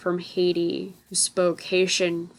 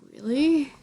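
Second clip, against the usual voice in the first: high, disbelieving "Really?"; pitched-up retold incredulity; incredulous; 8 semitones higher than usual. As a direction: toss it back in mock disbelief. Incredulous retort to teacher.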